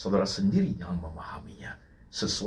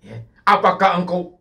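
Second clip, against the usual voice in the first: loud, high, urgent preaching; full-force preaching; 8 semitones higher, 9 dB louder than usual. Acted. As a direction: drive the questions home to the congregation.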